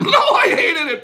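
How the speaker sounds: mock Frank. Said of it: "Frank says".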